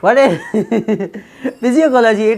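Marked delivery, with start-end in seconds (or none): 1.6-2.4 physiology laughed out higher and louder; mocking the misreading of physiology with a laugh; laughing wrong reading. Shown as a demonstration. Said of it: "It is physiology".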